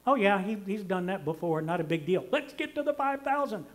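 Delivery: a listener skipping past the miracle, mock offhand; mock dismissive. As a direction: wave it off like it's nothing. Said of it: "we just kinda skip right over that one".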